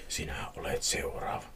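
low, hushed threat; soft, sinister echo of the threat; ominous whisper. A narrator's reading. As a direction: whisper the haunting words. Threat repeated from the nightmare.

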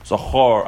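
Zachor with a full "o" in the last syllable; cholam vowel. Shown as a demonstration. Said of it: "with a cholam".

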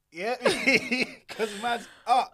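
high, slow, laughing; laughing boast. Real, not acted.

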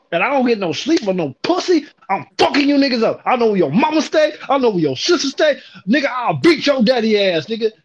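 angry threat. Retold or imitated. Boss threatening the workers to get upstairs, angry. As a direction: threaten them all.

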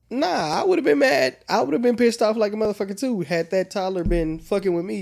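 heated outburst. Retold or imitated. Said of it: "I was like".

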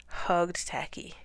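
plain reading voice

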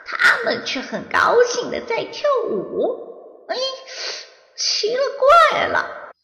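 high, puzzled monster musing to himself; high puzzled creature voice. Acted. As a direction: wonder aloud like a baffled monster. Monster puzzled inner thought.

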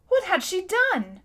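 high, swooping, amazed question; astonished question. A narrator's reading. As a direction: ask in wide-eyed astonishment. Boy shocked, asks about the crime.

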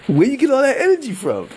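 high, amused, incredulous question through laughter; laughing disbelief. Retold or imitated. Teasing a hyped Knicks fan, laughing in disbelief. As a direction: ask it like you can't believe their energy. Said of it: "You know what I mean?".

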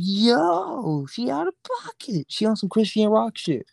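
high, put-on astonished voice; mock outraged friend. To his friend about the girl on the bus, high mock outrage. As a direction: squeal it like it's scandalous. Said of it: "I'm like".

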